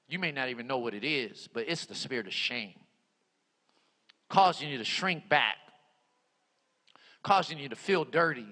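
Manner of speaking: low, subdued voice with long pauses; altar-call prayer. Real, not acted. Preacher prays for somebody wrestling with shame, altar-call prayer. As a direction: lead bowed heads gently into prayer. Leads closing prayer time.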